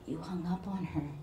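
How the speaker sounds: small, subdued voice; crackling voice. A narrator's reading. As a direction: say it faintly, voice nearly cracking. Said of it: "Her voice crackled".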